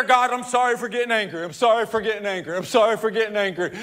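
fast, rattled-off sorry on repeat; hurried mock apology. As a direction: gabble the apologies like a habit.

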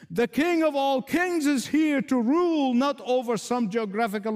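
high, sustained preacher's proclamation; ringing pulpit declaration, pitched high; full-force preaching. Acted. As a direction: proclaim it like a herald to the congregation.

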